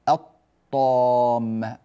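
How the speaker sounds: الطامة with silent ل and doubled ط; long-held vowel in الطامة after a doubled ط; assimilated lam. Shown as a demonstration. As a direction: skip the ل, straight into a heavy ط in الطامة. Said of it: "وليس، آه، كما ندغمها مثلًا".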